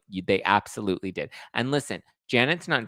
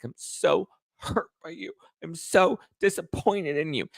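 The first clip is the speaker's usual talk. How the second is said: mock hurt; 8 semitones higher than usual. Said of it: "she's like".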